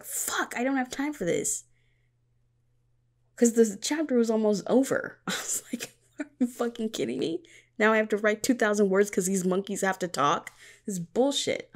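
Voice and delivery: comic indignant grumble; exasperated rant. Retold or imitated. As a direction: vent at your own story getting away from you. Annoyed at unplanned scene.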